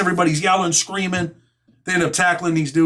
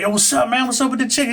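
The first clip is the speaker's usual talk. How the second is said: higher, easy questioning voice of a hungry inmate; lightly voiced inmate, a touch higher; eager inmate asking; 7 semitones higher than usual. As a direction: ask about the chicken like you can't wait. Victim asking for his chicken.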